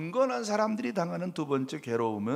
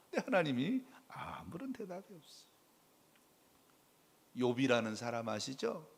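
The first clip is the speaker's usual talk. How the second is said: ordinary talk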